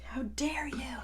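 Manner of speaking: whispered, hammed-up how dare you; joking whisper of mock offence; mock whispered outrage. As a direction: hiss it in fake indignation. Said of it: "I'm laughing and I'm like".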